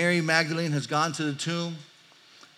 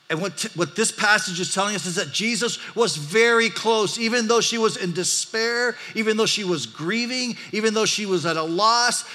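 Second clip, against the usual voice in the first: higher, louder preaching push; building sermon; 6 semitones higher, 5 dB louder than usual. Acted. Pastor stresses Jesus is close in grief, rising preaching voice. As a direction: drive the point home to the church.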